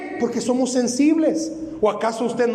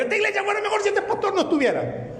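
higher, fast, laughing complaint; mock complaining member; 11 semitones higher, faster than usual. Church member complaining about the pastor, mock gossip. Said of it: "van a decir".

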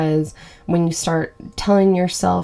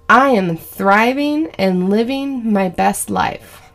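slow, deliberate affirmation for listeners to repeat; 3 semitones higher, slower than usual. Shown as a demonstration. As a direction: spell it out slowly so they can follow along. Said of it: "let's say that three times together".

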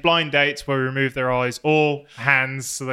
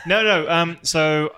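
normal talking voice

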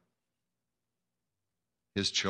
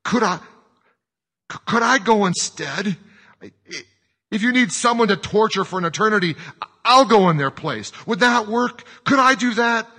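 loud, high, halting plea; desperate pleading; 11 semitones higher, 10 dB louder than usual. A narrator's reading. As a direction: offer yourself frantically, stumbling over words. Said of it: "pleading with his eyes".

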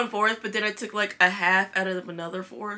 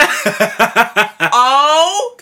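crying with laughter; laugh-sobbing through the words; laughing hysterically; 3 semitones higher, 9 dB louder, much slower than usual. Real, not acted. Laughing-crying about pickles.

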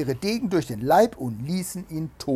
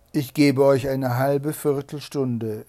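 stern Bluebeard; 9 dB louder than usual. A narrator's reading. Bluebeard grants a short respite.

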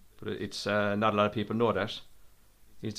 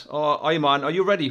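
ordinary talk

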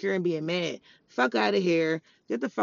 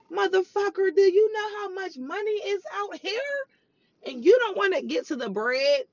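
incredulous high rant; 10 semitones higher than usual. Real, not acted.

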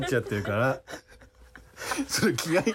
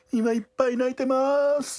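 mock crying; 9 semitones higher than usual.

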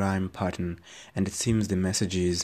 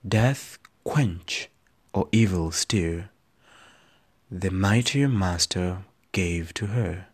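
slow, dramatic poetry performance; poem recitation; slower than usual. Acted. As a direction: read it like a stage poem.

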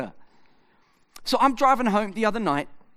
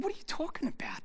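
incredulous whisper; 7 semitones higher, 14 dB quieter, faster than usual. Retold or imitated. Pastor incredulous at young adult.

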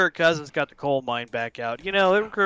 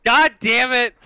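mock frustration; 6 semitones higher, 5 dB louder, slower than usual.

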